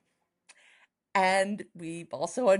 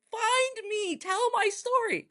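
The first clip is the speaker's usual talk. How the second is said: high, slow eerie ghost call; high drawn-out ghostly plea; 14 semitones higher, slower than usual. Retold or imitated. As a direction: wail it like a ghost from the grave. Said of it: "crawling out of a grave going".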